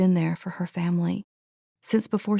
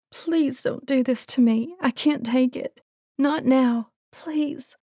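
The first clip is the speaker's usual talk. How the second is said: pleading; 7 semitones higher, slower than usual. A narrator's reading. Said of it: "she pleaded out loud".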